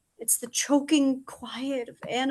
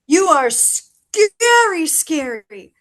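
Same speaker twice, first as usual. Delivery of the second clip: pleading emphasis; 4 semitones higher, 9 dB louder, slower than usual. Acted. Crow pleads with Scarecrow.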